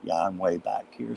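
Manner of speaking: usual speaking voice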